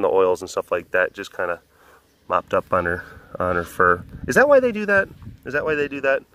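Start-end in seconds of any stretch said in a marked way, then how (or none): 4.3-5.1 first take much higher, curious; high questioning. Shown as a demonstration. Asking the animals, high and curious. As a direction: ask it up high, wondering. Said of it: "repeats question, first take high".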